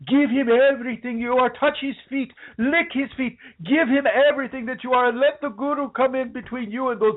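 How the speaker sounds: high, put-on Indian accent of a guru's follower; high-pitched mock Indian accent that slides toward Mexican; bad Indian accent. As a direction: play a devotee urging you to your guru in a shaky Indian accent. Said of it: "I'm not really good at my Indian accent today".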